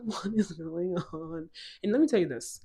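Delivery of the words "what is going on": slow, drawn-out bewilderment; mock-baffled slow question; bewildered. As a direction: stretch it out in dazed disbelief. Her own bewildered reaction, retold.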